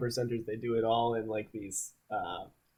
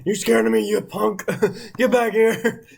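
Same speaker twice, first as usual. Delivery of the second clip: mock tough guy; 8 semitones higher, 6 dB louder than usual. Hunter mock threat, laughing.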